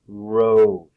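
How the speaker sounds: "road" loud, the O stretched; long O demonstration. Shown as a demonstration. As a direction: draw out the O in "road". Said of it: "it's the long O sound".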